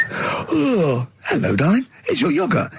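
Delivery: high cooing yogurt voice; talking yogurt voice. Retold or imitated. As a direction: coo like a friendly talking yogurt. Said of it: "a little scenario, an embellishment".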